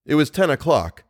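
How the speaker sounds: usual speaking voice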